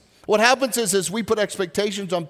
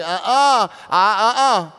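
mock refusal; 2 semitones higher, 5 dB louder, slower than usual. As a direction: refuse it like a stubborn child. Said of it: "in some areas we're like".

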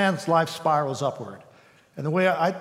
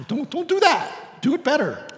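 higher playful mock warning; mock Jesus warning; 10 semitones higher than usual. Jesus teasing the believer not to copy, higher playful warning. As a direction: tease them off copying him. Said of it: "And Jesus says".

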